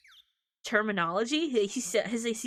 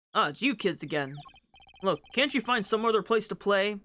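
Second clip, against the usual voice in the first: mildly lowered tired detective; gruff detective voice. Acted. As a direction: shoo the kids off, mildly annoyed.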